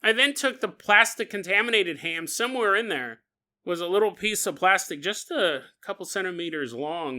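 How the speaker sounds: ordinary talk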